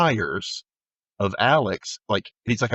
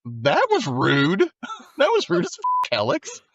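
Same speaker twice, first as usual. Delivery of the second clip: high, laughing offended squeal; laughing mock indignation; 14 semitones higher than usual. Retelling Lenny scolding Alex, laughing indignant high voice. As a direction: complain through laughter.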